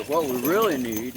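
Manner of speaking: usual speaking voice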